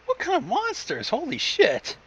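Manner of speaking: usual speaking voice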